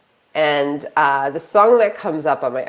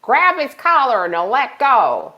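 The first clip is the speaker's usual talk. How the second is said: calling across lawn; 6 semitones higher than usual. Retold or imitated. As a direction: imitate an old man calling from afar. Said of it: "I hear from across the lawn".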